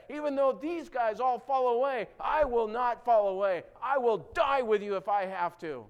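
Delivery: loud, high, fervent declaration as Peter; emphatic vow. Retold or imitated. Peter vowing to Jesus before the disciples, emphatic loud shout. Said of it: "making it most emphatic".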